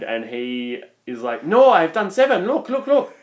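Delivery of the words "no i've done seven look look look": higher-pitched, hammed-up Vietnamese accent; badly faked Vietnamese accent, protesting insistently; attempted Vietnamese accent. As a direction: imitate an insistent driver with a clumsy Vietnamese accent. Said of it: "I'm trying to do the accent".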